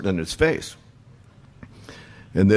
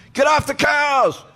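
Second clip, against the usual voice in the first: high, slow shouted scold from a passing car; drawn-out high yell; mock yell; 3 dB louder, slower than usual. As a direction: yell it out the window at them. Passing drivers yelling at band.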